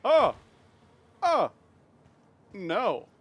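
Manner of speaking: sudden loud startled cries; startled yelps. Real, not acted. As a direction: jump and yelp in alarm. Startled by something in game.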